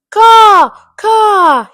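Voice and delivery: loud, high drawn-out cawing; shouted crow caw. A narrator's reading. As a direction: caw out like a crow calling for attention. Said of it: "he shouted".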